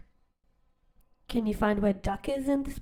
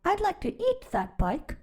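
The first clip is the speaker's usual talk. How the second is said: hungry goat; 8 semitones higher than usual. Acted. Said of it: "what he thought was".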